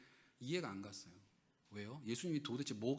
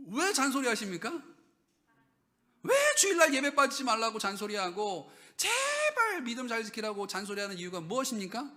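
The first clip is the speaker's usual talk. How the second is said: loud, high preaching to the congregation; full-force preaching; 7 semitones higher, 7 dB louder than usual. Acted. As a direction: drive the point home from the pulpit.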